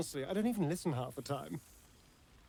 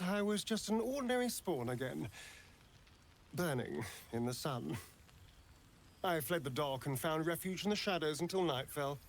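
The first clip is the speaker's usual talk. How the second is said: normal talking voice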